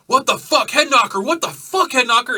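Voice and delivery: loud, high shouted challenge; angry biker yell. Acted. Biker yelling at the brawler to stop, loud and high. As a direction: bark it at him like a furious biker. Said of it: "Gus and the other guys come running".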